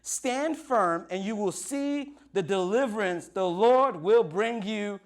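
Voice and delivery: high, loud, commanding preacher's reading; ringing, emphatic declamation; rousing scripture proclamation. Acted. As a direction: proclaim it boldly to the crowd. Reads Moses' answer as proclamation.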